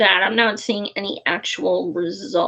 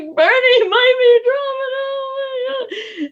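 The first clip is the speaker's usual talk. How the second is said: much higher, wailing mock sob, slowing at the end; ugly crying. Telling husband Bernie may drop, wailing mock sob. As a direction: replay her own ugly crying, pitched way up. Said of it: "the way I was ugly crying".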